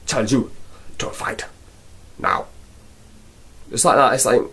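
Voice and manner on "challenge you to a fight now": soft, flat badly dubbed line; dubbed kung fu villain. Mocking an old martial arts film, lightly dubbed. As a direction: deliver it like an old dub. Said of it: "old Jackie Chan martial arts type".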